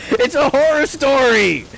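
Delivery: high, loud, drawn-out protest; hammed-up high-pitched shout; mock outraged yell. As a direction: yell it in exaggerated comic indignation. Mock outburst defending himself to chat.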